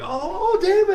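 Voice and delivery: high, loud, drawn-out hello; mock excited greeting. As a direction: call his name as if thrilled to see him. Mock greeting to cue co-host.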